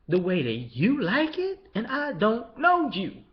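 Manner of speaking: high, slow, disbelieving questions; incredulous. Retold or imitated. Replying to a YouTube commenter, high and slow in disbelief. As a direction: stretch it out in disbelief.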